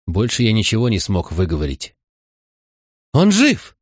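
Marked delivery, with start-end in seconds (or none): 3.1-3.8 high, loud, happy cry; joyful shout. Acted. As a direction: call out to everyone with joy. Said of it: "громко крикнула она остальным".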